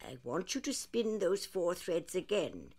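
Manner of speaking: ordinary talk, nothing marked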